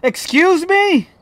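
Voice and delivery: high, loud indignant yelling; loud rant. Real, not acted. Gamer ranting about the ghost's true type, loud indignant yelling. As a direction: yell the evidence list at the game.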